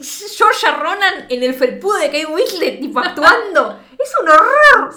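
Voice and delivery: escalating shocked laugh-cry; scandalized outburst. Real, not acted. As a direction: squeal in mock horror, breaking into a half sob. Scandalized by explicit scene.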